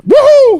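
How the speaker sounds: loud, high "woohoo"; exultant high cheer; celebratory whoop. Retold or imitated. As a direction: whoop at the top of the voice in victory. Celebrating dropped charges.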